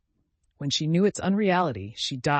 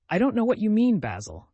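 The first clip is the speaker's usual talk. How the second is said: sharp high exclamation, louder and higher; exclaiming; 8 semitones higher, 7 dB louder than usual. A narrator's reading. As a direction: burst out in protest. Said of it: "he exclaimed".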